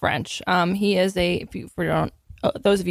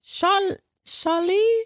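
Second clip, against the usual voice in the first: put-on high, hesitant French-accented dog; 10 semitones higher, much slower than usual. Dog asked to say its name, high French accent. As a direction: try the name as a squeaky French pup. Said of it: "say Charlie in an accent".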